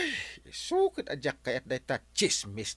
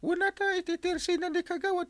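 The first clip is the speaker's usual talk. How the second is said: mock high voice. Gossip scene, sudden high voice.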